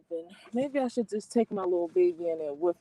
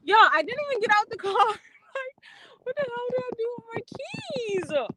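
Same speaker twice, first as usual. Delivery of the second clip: high-pitched, half-laughing whine; laughing disbelief; 13 semitones higher than usual. Real, not acted. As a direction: wail it through real laughter, high. Laughing in disbelief at lost keys.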